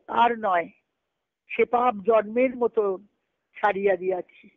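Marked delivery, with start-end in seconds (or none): none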